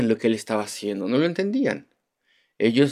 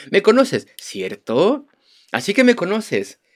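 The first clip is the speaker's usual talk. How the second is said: high, loud mocking "¿Me conoces?"; ironic; 8 semitones higher, 4 dB louder, slower than usual. Jesus teasing the crowd in the temple, ironic and loud. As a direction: throw it back with irony, much higher and louder. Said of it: "esta es una gran ironía".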